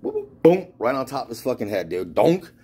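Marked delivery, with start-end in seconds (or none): 0.0-0.7 high, loud whooping then boom; cartoon whirl ending in a thud; bottle flight noises. Imitates bottle flying and hitting. 2.2-2.5 loud, high imitated donk; cartoonish hollow bonk; impact noise. Imitates bottle hitting the head.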